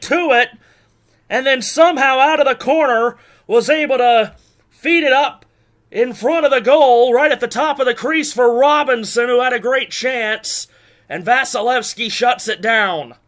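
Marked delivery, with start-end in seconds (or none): none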